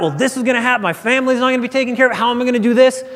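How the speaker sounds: high, fast, panicked rush; imitating his own past panic, high and rushed; freaked out. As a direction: blurt it out in a rising panic. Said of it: "I'm sure I would have freaked out".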